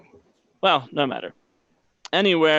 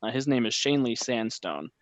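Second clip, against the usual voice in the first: usual speaking voice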